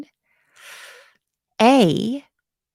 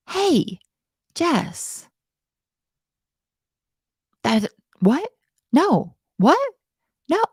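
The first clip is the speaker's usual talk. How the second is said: confused student; 7 semitones higher, slower than usual. Pretend. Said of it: "And you say".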